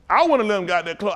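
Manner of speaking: ordinary talk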